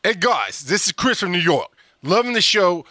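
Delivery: badly faked New York accent; horrible New York accent. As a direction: put on a terrible New Yorker voice. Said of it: "my horrible New York accent".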